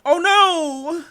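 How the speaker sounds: high, loud cry of dismay; dismayed shout. Real, not acted. Streamer shouting at bad news for his team, loud dismay. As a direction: yell it out in real shock.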